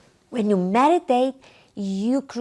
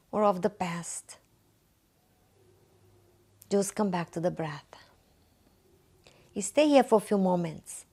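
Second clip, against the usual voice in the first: guided meditation; 4 semitones lower, 5 dB quieter than usual. Acted. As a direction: guide the listener gently through breathing, unhurried and calm. Leads a guided breathing meditation.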